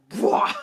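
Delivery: loud sudden "bwah" of falling; mock slipping yelp. Slipping down the stairs, loud mock yelp. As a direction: yelp as the foot slides.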